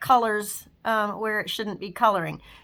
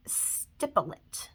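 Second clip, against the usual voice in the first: stipple said slowly, breathy and low, nearly voiceless; soft stressed word; 4 semitones lower, 9 dB quieter, much slower than usual. Shown as a demonstration. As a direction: drag out stipple it softly, as if savouring the word. Slow soft emphasis on technique.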